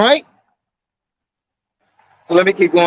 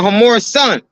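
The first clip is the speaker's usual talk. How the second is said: usual speaking voice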